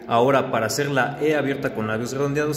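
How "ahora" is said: ordinary delivery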